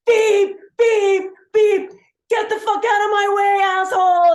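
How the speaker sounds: loud, very high honk-and-yell; road-rage shout. Retold or imitated. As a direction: blast it like a furious driver leaning on the horn. Another driver honks and yells.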